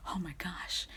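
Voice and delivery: hushed, whispered amazement; awed whisper. Retold or imitated. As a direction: imitate a stunned crowd under your breath. Retelling crowd's stunned reaction.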